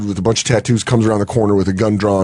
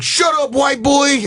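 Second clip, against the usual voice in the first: gangster yelling; slower than usual. Retold or imitated. Retelling the robber yelling at him, high mock shout. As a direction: bark it like a tough little gangster. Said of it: "he just kept being like".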